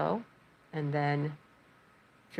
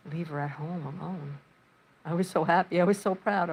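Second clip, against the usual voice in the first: ordinary talk, nothing marked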